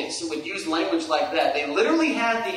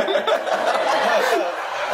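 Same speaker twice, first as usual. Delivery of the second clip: laugh; 12 semitones higher, 4 dB louder than usual. Real, not acted. Laughing at own joke.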